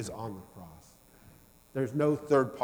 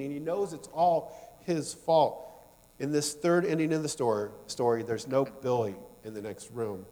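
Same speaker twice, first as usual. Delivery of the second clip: usual speaking voice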